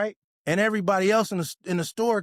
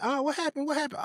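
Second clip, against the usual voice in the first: worried family member; 9 semitones higher, 4 dB quieter than usual. Retold or imitated. Imagines worried family asking.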